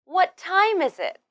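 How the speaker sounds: "What time" stressed high, then falling to the end; high start on "What time is it", falling finish; falling intonation. Shown as a demonstration. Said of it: "use a falling intonation at the end".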